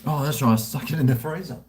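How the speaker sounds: ordinary talk, nothing marked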